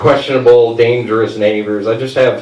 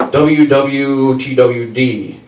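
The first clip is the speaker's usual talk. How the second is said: slow spelled-out letters; much slower than usual. Retold or imitated. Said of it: "I'm like".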